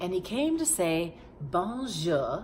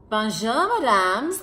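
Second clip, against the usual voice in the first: loud cheerful greeting; 5 semitones higher, 10 dB louder than usual. Acted. Lion greets ladies.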